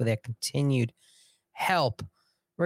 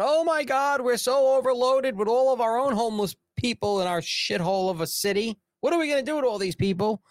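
high-pitched hammed-up freak-out; mock panic; 9 semitones higher, 3 dB louder than usual. Cities receiving migrant buses, high mock panic. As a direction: wail it in exaggerated overwhelmed panic. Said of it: "go into a panic".

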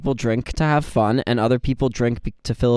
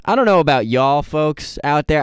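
put-on Southern accent. Addressing the audience in a sudden Southern accent. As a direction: slip into a Southern accent for a moment. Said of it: "I don't know why I went Southern for a second".

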